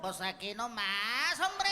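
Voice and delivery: slow, drawn-out lazy flow; dragged-out Mexican Spanish. Retold or imitated. Comedian imitates Mexicans speaking slow and drawn out. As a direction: stretch every word out. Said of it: "we drag it out".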